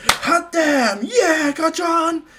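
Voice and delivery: high, loud cheering shout; loud hyped-up congratulation; whooping cheer. Retold or imitated. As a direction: whoop it up for your friend. Izuku cheers Bakugo's news.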